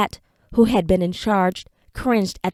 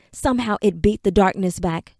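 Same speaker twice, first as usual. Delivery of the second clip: plain reading voice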